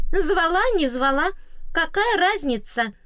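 high, loud squeaky brush-off; a fairy waving the question away; high fairy scoff; dismissive fairy. A narrator's reading.

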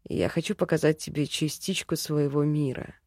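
lightly voiced low elf; gentle male elf. A narrator's reading.

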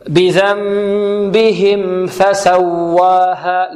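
"بذنبهم" with the meem sound held at medium length, slow recitation; measured tajweed demonstration of the meem in "بذنبهم"; medium flow on the meem. Shown as a demonstration. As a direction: chant it very slowly, letting the nasal meem in "بذنبهم" flow. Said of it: "جريان متوسطا هكذا".

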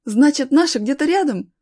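high, loud, eager question; acted boy's excitement; hopeful excitement. A narrator's reading. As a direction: catch on with sudden hope. Boy realises rescuers are near.

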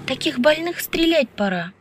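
high-pitched, convinced verdict; firm conviction. A narrator's reading. Woman to the professor about the poet, convinced. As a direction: state it high, with no doubt. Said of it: "убеждённо сказала Аля".

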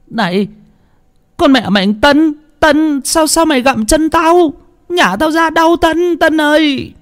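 high, panicked shriek of pain. A narrator's reading. Starving boy shrieking at his sister gnawing his leg, high panicked shriek. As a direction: cry out high as if your leg is being bitten. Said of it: "Nó rú lên".

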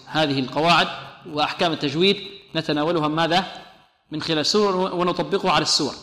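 usual speaking voice

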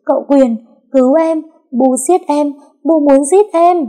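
high, loud screams, getting wilder; faked victim screaming for rescue; screaming for help. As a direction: shriek to the husband as if being killed. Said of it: "ôm mặt hét lớn".

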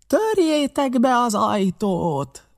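high, drawn-out shout; bellowed order to the gang; shouted command. A narrator's reading. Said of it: "kiáltotta".

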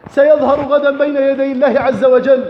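ordinary delivery

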